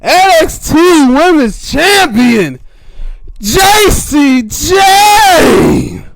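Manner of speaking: huge, high, slow arena announcement; emphatic ring announcer. Shown as a demonstration. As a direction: stretch the name out at a full shout. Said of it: "he should have put more emphasis".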